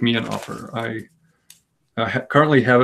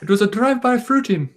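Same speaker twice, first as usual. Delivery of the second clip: much higher, zany comic voice; Robin Williams; 11 semitones higher than usual. Retold or imitated. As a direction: toss it off like a manic comedian. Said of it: "I'm supposed to be Robin Williams".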